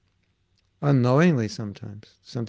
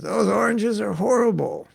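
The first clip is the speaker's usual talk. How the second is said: high-pitched mock disapproval; imitating Prabhupada's complaint; 9 semitones higher, 3 dB louder than usual. Guru complaining to devotees about the oranges, imitated high. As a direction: voice the guru scolding the fruit, pitched up.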